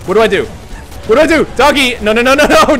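high loud frantic questions; panicked. Real, not acted. Player panicking with no idea what to do, loud high frantic questions. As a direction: scramble for what to do.

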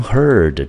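slow high teacher model of heard; careful model word. Shown as a demonstration. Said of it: "英文念成". (老师放慢拔高示范heard)

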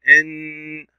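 إن held on a long n; sustained humming n in إن; shadda sound. Shown as a demonstration. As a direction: linger on the n of إن. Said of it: "ننطق الشدة أولا".

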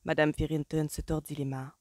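ordinary delivery, nothing marked

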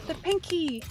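gasping with delight, high; excited gasp. Real, not acted. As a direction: squeal the name in delight. Gasps at the demon.

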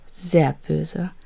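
usual speaking voice

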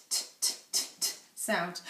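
t as a crisp unvoiced tap, repeated; phonics sound t. Shown as a demonstration. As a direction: tap out t, t, t with no vowel after it. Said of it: "Where's the t".

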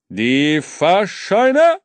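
langsamer, lauter Schaffnerruf; Schaffner ruft. A narrator's reading. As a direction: rufe gedehnt wie ein Schaffner. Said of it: "rief hinter ihm eine Stimme". (lauter, gedehnter Schaffnerruf)

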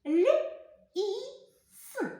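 each sound said apart, high and clear; separate sounds. Shown as a demonstration. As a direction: sound out Р, А, К one by one. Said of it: "Разом поєднайте".

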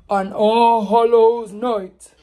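slow drawn-out ghost story call; loud spooky story opening; spooky storytelling. Acted. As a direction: stretch each word like a haunting.

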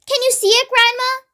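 high-pitched, eager call; excited shout. A narrator's reading. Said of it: "George shouted".

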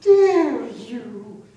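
huffy, indignant caricature of an American; offended American. Retold or imitated. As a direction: play the scandalised patriot, clutching pearls. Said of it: "And they're like".

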